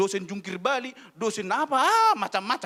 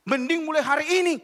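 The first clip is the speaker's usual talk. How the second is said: raised, higher scolding; loud scolding; 7 semitones higher, 6 dB louder than usual. Retold or imitated. Lecturer telling a student to quit, loud scold.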